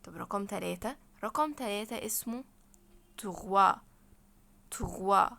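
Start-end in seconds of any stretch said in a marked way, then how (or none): 3.2-5.3 "تغوا" as French trois, ending in an open "wa"; silent S. Shown as a demonstration. Teacher models number three for students, "تغوا" with silent S. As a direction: say "تغوا" louder, French R, no final S. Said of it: "الإس برضو مش منطوقة".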